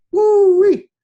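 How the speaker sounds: very high, loud yelp of delight; excited whoop. Real, not acted. Coach cheering about revealing industry secrets, loud whoop. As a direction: let out a big whoop.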